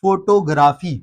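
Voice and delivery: फोटोग्राफी as FO-to-GRAA-fee; full O and long AA in फोटोग्राफी; wrong pronunciation. Shown as a demonstration. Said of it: "total गलत use करते हैं". (फोटोग्राफी का ग़लत उच्चारण, फ़ो-टो-ग्रा-फ़ी)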